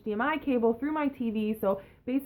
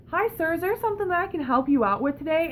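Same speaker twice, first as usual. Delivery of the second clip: mock clerk; 7 semitones higher, faster than usual. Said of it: "and he's like".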